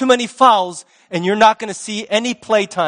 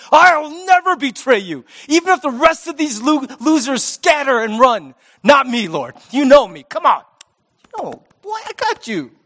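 brash boast; 4 semitones higher than usual. Retold or imitated. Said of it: "how brashly, how boldly".

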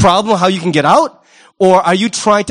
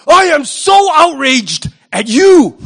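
slow, higher, louder fake indignation; mock outrage; 6 semitones higher, 5 dB louder, slower than usual. Preacher mocking a hypocrite, slow and higher with hammed-up outrage. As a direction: ham up the self-righteous anger.

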